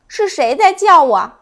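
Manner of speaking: surprised. A narrator's reading. 洋葱头在黑牢里听见声音，惊讶地问. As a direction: ask in sudden surprise. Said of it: "他惊讶地问".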